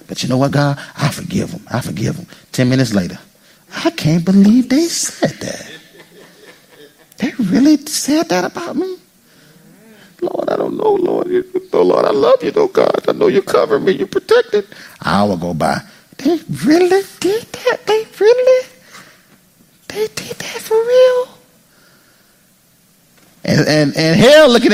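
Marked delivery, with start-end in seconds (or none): 0.1-2.3 hushed piety. Retold or imitated. Mock pious claim, hushed. 3.8-14.7 mock-wounded whining to God, pitched high; high whiny complaint. Acted churchgoer stewing to God. 16.2-21.3 high, slow, whispered disbelief; mock disbelief.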